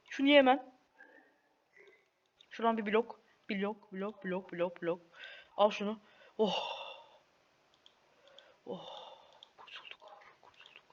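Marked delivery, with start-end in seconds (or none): none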